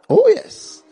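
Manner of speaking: high ringing "Oh, yes" to the crowd; loud call-back. Real, not acted. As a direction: rouse the congregation.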